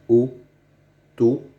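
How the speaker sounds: "autobus" stretched slowly, the O drawn out; slow demonstration. Shown as a demonstration. Teacher showing pupils how autobus sounds, slowly. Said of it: "On dit".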